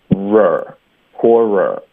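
"r", then "poorer", slowly with a held r ending; r-coloured ending. Shown as a demonstration. Teacher shows how to add the ending, slow "r, poorer". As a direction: draw out "poorer", adding the final r syllable. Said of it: "如果你会发一个r的音呢".

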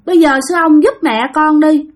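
put-on high, begging child's voice; pleading little child. Child spirit begging the monk to save her mother, high pleading voice. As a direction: whine and beg like a small kid. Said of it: "mới năn nỉ sư ông".

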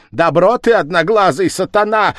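high, loud scolding shriek; angry cry. Acted. Sister-in-law rounds on village head, high angry cry. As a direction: cry out in indignation. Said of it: "вскричала она".

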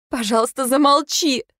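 high pleading cry rising to a shout; begging scream. Acted. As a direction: plead then scream at him.

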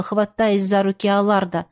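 plain reading voice

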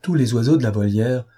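usual speaking voice

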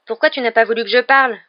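indignant, loud and high; angry protest. A narrator's reading. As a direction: protest hotly to a friend. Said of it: "protesta Ron avec colère".

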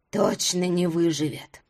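put-on low slow old woman voice; old woman muttering. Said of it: "что-то очень тихо обронила".

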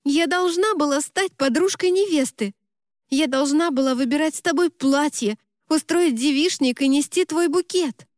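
high-pitched aggrieved lament; moaning complaint. A narrator's reading. Best friend who missed being bridesmaid, moaning complaint. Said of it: "простонала Америка".

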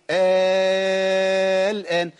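sung, very long held "aa" before الآن; long madd. Shown as a demonstration. Teacher showing the substituted alif, long madd into الآن. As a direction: hold آه on one steady note, then الآن. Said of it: "لازم أمد ست حركات".

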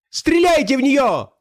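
high, drawn-out frantic cry to his bodyguards; slow high-pitched yell; shouted order. A narrator's reading. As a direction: shout it high as a desperate command. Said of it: "крикнул он телохранителям".

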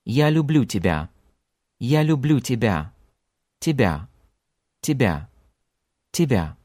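тебя with a weak, reduced ya at the end; reduced final Ya. Shown as a demonstration. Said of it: "this reduced sound at the end again".

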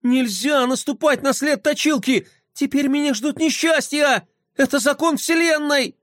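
высокий панический голос; запаникуй всерьёз; паника. Acted. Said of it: "Флай сразу запаниковал". (паническая скороговорка о несчастьях)

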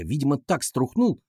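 usual speaking voice

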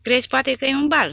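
loud, high, scornful jeer; mocking shout. Porta taunting a frightened recruit, a loud mocking shout. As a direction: yell the taunt in his face.

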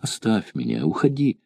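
half-whisper, breaking off between words; broken whisper. A narrator's reading. A trembling girl begs her suitor to stop, in a broken whisper. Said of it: "Она прерывисто шептала".

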